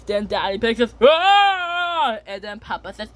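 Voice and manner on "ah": high, drawn-out "ah"; long mock-frightened cry; acted scream. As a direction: yell as a wave comes at you. Daddy Pig hit by waves.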